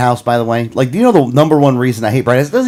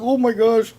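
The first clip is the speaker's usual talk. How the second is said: pained groan; 9 semitones higher, 6 dB quieter, slower than usual. Retold or imitated. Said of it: "I'm like".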